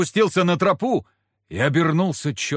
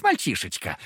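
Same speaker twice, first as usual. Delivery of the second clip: gloating devil; 6 semitones lower than usual. Acted. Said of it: "снова забормотал Фока".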